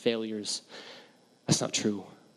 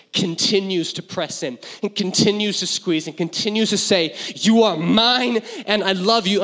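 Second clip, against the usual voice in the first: higher, louder preaching, pressing in; impassioned preaching; 5 semitones higher, 5 dB louder than usual. Acted. Pastor proclaiming God's love to congregation, impassioned. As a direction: drive the promise home.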